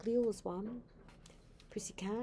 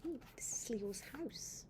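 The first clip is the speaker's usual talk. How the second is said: breathy hushed ooh; whispering; 2 semitones higher, 8 dB quieter than usual. Real, not acted. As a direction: whisper it in wonder. Grandmother reading picture book softly.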